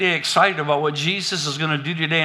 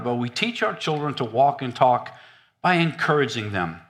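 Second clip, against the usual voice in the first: normal talking voice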